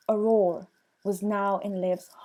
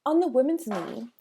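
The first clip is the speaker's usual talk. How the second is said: ordinary delivery, nothing marked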